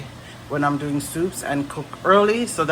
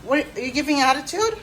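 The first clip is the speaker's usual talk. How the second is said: higher, teasing mock-stern question; 11 semitones higher than usual. Real, not acted. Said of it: "repeated to the child".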